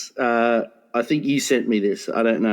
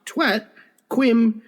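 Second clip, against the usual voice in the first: ordinary talk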